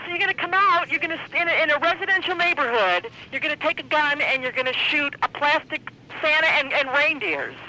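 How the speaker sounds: real appalled protest; indignant disbelief. Real, not acted. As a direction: spell out the absurdity to the caller. Recaps the absurd threat, outraged.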